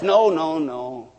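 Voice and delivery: low, slow, glum mock voice; mocking gloomy church. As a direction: drag it down like a killjoy. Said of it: "But the church says".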